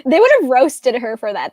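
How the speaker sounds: ordinary talk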